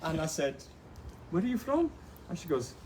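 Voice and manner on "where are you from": incredulous question. Retold or imitated. Kayaker closing in on a neighbour's village, high and incredulous. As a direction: lift the question high in mock suspense. Retold question, suspense before the reveal.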